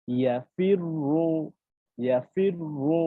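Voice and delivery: slow ইয়াফির রু with full, thick র; ইয়াফির রু recited slowly, thick র; heavy ra. Shown as a demonstration. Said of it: "জবর অথবা পেশ হলে পুরো হবে".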